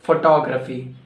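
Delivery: "Photography" as fo-TOG-ra-fee; corrected PHOTOGRAPHY. Shown as a demonstration. Teacher correcting learners, "Photography" said as fo-TOG-ra-fee. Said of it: "No, it's incorrect".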